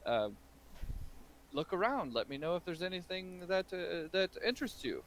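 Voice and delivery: put-on a nervous old merchant ushering the ogre to browse; polite shopkeeper. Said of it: "He's like".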